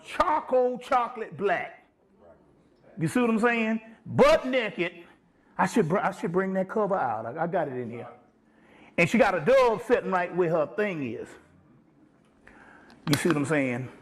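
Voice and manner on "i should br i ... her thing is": ordinary talk, nothing marked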